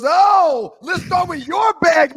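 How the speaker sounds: loud, high, drawn-out mock cop order; pouncing cop. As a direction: pounce on a suspect like a triumphant cop.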